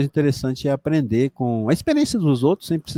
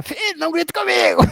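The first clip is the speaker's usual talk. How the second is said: mock yelling father. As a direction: yell it like a dad losing his temper, in caricature. Father yelling at his son.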